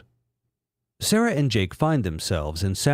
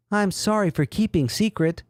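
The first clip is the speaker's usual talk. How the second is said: Umbreon voice; 7 semitones higher than usual. Acted.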